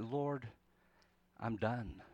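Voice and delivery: low, soft, defeated prayer; weary longing. Acted. Any of us at the end, praying wearily to the Lord. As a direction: let each phrase drop away, utterly spent. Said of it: "we get the same weary longing".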